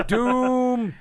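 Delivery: high ominous doom. Retold or imitated. Joining the doom bit.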